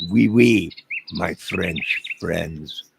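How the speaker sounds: loud hammed-up French "oui, oui"; hammed-up French hello; mock French. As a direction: greet them like a cheesy Frenchman. Mock French greeting to Canada.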